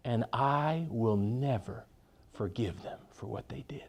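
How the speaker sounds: slow, then dropping to a whisper; a low hushed vow of grudge; bitter vow. Retold or imitated. Hurt woman vowing never to forgive, slow hushed whisper. Bitter lady voiced in story.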